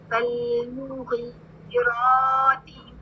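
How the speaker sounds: फलमुग़ीराति very slow with held vowels; slow recitation demo. Shown as a demonstration. Teacher models the word the class gets wrong. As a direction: recite फलमुग़ीराति deliberately slowly. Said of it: "please सब गौर से सुने इसको कैसे पढ़ते हैं".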